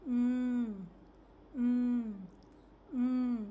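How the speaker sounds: "Mm" hummed loudly through the nose; nasal m sound. Shown as a demonstration. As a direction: hold the "Mm" with closed lips. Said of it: "can you repeat after me".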